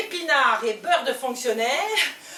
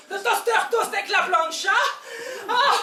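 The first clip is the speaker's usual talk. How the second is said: ordinary delivery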